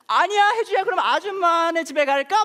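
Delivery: very high, loud, hammed-up panic; mock alarm. Alarmed neighbour offers Hyeju her house, mock panicked. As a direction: imitate a horrified woman rescuing a child, words stretched out. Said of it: "얼굴이 완전히 놀라가지고".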